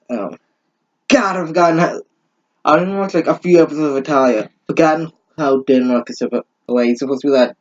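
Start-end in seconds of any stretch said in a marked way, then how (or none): none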